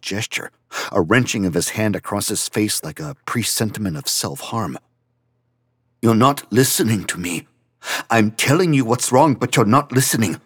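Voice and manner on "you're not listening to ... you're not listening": loud, high, upset at being ignored; fast and rising anger at the end; angry outburst. Acted. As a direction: raise your voice, he isn't hearing you. Franz angry, crying.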